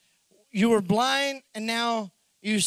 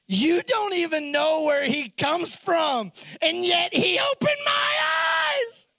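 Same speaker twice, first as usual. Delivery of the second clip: triumphant retort; 7 semitones higher, slower than usual. Retold or imitated. Healed man's triumphant retort.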